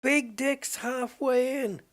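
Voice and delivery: slow, high, drawn-out shout; loud mock yell, every word stretched; shouting back. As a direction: holler it back slowly to the house. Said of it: "then he shouts back".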